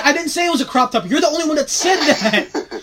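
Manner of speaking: high, loud, rapid-fire objection; indignant protest. Real, not acted. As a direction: throw the blame back, exasperated and half laughing. Protests being blamed for crop top joke.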